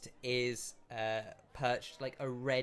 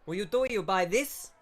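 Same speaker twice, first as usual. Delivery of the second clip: louder, higher, fast mock Italian shopkeeper; shopkeeper accent; 5 semitones higher, 5 dB louder than usual. Shopkeeper brushes off customer, louder fast mock Italian accent.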